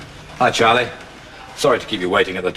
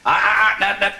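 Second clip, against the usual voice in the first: high, urgent stammered protest; alarmed warning; 10 semitones higher, 6 dB louder than usual. Acted. Father stopping someone showing the post, alarmed. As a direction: cut them off, high and urgent.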